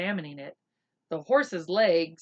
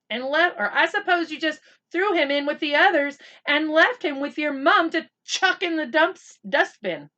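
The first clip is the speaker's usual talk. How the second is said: accusing; 8 semitones higher, 7 dB louder than usual. Acted. Boy furiously accusing his friend.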